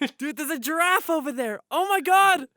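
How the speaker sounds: high, loud amazed outburst; excited tourist. Acted. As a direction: holler in mock awe at the sight. Acted awestruck safari tourists.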